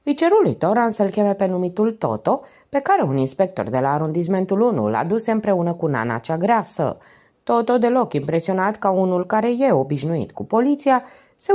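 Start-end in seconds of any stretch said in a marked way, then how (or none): none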